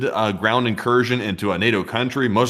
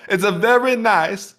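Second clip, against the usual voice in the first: high, slow, loud Borat-style It's a very nice; joking about Kazakhstan, slow, high Borat voice; Borat impression; 10 semitones higher, 4 dB louder, slower than usual. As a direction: ham up a Kazakh accent, very nice. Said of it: "like in Kazakhstan".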